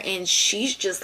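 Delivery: ordinary delivery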